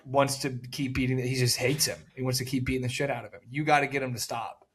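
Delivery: ordinary talk, nothing marked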